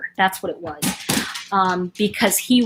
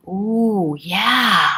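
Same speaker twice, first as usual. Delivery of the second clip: ordinary talk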